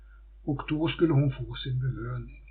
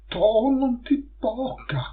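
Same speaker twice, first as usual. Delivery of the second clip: tailors' pleading; 7 semitones higher, 6 dB louder than usual. Acted. Frightened tailors beg her.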